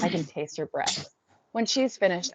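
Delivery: ordinary delivery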